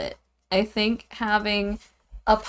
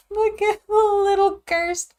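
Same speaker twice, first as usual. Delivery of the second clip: cooing; 11 semitones higher, 3 dB louder than usual. Real, not acted. Cooing at drawing.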